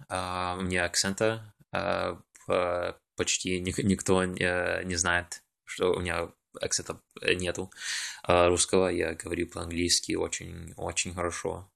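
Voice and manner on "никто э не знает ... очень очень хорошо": ordinary talk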